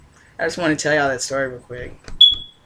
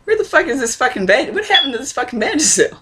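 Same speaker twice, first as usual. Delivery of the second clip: higher, annoyed mom voice; imitating mom hunting for the missing tube; 7 semitones higher than usual. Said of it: "my mom is like".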